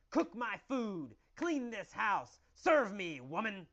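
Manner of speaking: slow, loud, high commands; bossy husband yelling. Acted. Husband ordering wife around, loud slow yelling. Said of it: "Every day he yells at Kathy".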